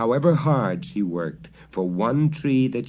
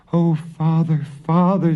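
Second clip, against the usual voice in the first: high, loud sobbing cry; sobbing girl; 8 semitones higher, 6 dB louder than usual. Acted. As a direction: cry for her father. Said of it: "sobbed Marusha".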